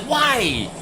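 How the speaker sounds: very high squeaky whine of disbelief; a hammed-up high-pitched why; indignant why. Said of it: "I was like".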